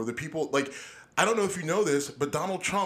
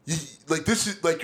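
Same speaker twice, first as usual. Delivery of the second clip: ordinary delivery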